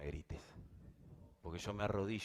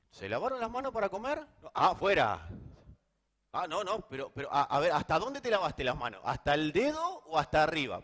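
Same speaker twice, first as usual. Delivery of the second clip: mock Pharisee; 10 semitones higher, 9 dB louder than usual. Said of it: "mocking Pharisee questions".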